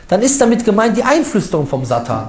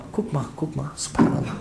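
hushed aside; 5 semitones lower, 12 dB quieter than usual. Real, not acted. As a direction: drop to a near whisper, almost breathless. Amazed aside while reading list. (leise geflüstertes Staunen)

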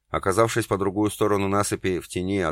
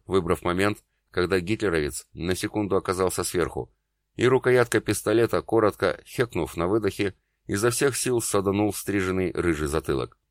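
ordinary delivery, nothing marked

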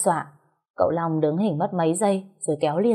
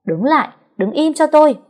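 much higher, louder shout while chasing; furious yell; 10 semitones higher, 8 dB louder than usual. A narrator's reading. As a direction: scream at him to stop. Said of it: "la hét". (hét lớn giận dữ khi đuổi theo)

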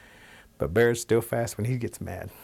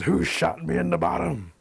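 raised, higher indignant demand; angry bear; 7 semitones higher than usual. Acted. Bear demands who shot him, angry raised voice. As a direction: roar the question at the forest.

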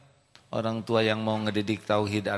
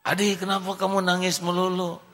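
put-on high, loud child's question; 10 semitones higher, 6 dB louder than usual. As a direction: ask like a puzzled little kid. Child asks again. (suara anak kecil heran)

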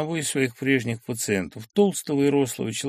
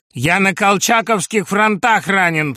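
louder, higher, slow barking; barked claim; 9 semitones higher, 9 dB louder than usual. Acted. Sharikov going all in, barking his claim. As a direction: bark the lie defiantly. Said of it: "пролаял он".

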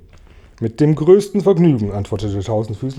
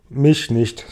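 ordinary delivery

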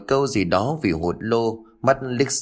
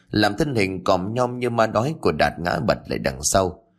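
ordinary delivery, nothing marked

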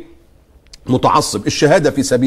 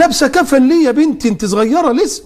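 much higher, sing-song pity; mock pity; 9 semitones higher than usual. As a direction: overdo the fake sympathy. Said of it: "طالعة بتقول لك".

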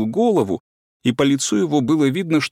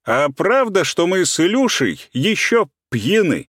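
higher, mocking and distinct; mocking, crisp; 8 semitones higher than usual. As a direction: tease the whole table, clear and sharp. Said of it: "насмешливо отчётливый голос".

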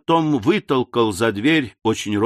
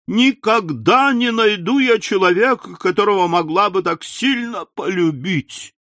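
higher, tearful complaint; grieving lament; 7 semitones higher than usual. A narrator's reading. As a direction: pour out her despair. Widow wails in grief. (причитает, высоко и горестно)

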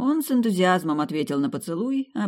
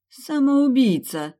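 horrified exclamation; 9 semitones higher, 6 dB louder, much slower than usual. A narrator's reading.

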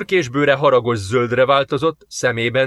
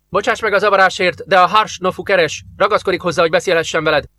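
lightly voiced alien operator, higher and polite; a slightly raised, polite alien operator apologising; 7 semitones higher, 3 dB louder, faster than usual. A narrator's reading. (enyhén megemelt hangú, udvarias idegen operátor)

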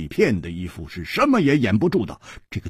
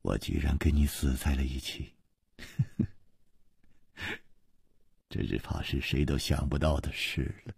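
soft, low, forlorn amusement; desolate smile; 6 semitones lower, 8 dB quieter than usual. A narrator's reading. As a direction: speak low and quiet through a sad smile. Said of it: "凄凉的微笑".

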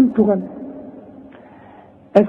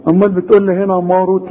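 ordinary talk, nothing marked

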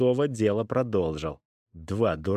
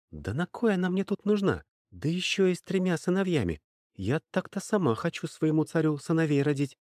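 high, fast grumble under breath; grumble, higher and quicker; grumbling young woman; 8 semitones higher than usual. A narrator's reading. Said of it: "пробурчала она".

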